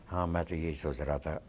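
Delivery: lightly mock the understated actor; slow and soft. Imitating a co-actor who speaks too softly, quietly. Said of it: "आहिस्ता बोलते हैं बहुत".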